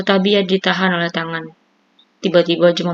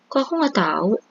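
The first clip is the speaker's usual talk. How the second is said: a lifted, puzzled question; confused; 4 semitones higher than usual. A narrator's reading. Wife puzzled that husband woke unnoticed, confused. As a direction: ask it bewildered, a bit higher. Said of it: "tanyanya bingung".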